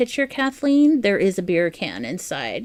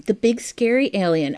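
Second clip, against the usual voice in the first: ordinary talk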